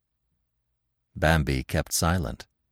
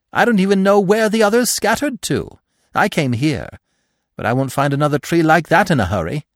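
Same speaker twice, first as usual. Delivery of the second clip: high loud squirrel, rushing at the end; squeaky and forlorn, words tumbling out; homeless squirrel; 9 semitones higher, 6 dB louder than usual. A narrator's reading. Squirrel telling Bambi they scattered, high and forlorn.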